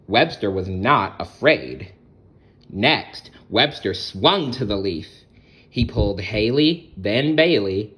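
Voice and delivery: bright, lively read-aloud voice; storybook narration. A narrator's reading. Storyteller reading aloud to children. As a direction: tell it like a bedtime picture book.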